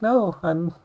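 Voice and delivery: high, stammering, caught-off-guard reply; lightly voiced nervous self; flustered answer. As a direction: trip over your words, embarrassed. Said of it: "I was like".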